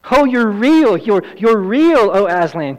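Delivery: high, loud, overjoyed exclamation; joyful cry. Acted. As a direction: cry out in disbelieving joy. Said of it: "cried Lucy".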